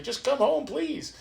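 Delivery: a father begging his wild son to come home; pleading dad. Retold or imitated. Dad asking his son on the phone to come home, lightly pleading. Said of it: "my dad's like".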